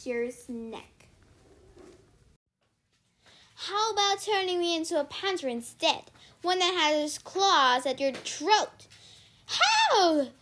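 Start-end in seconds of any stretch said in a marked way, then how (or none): 3.5-8.9 raised, louder threat; threatening. A narrator's reading. Annabeth threatens Circe at knifepoint. 9.5-10.3 high, loud startled yelp. A narrator's reading. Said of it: "Sears yelped".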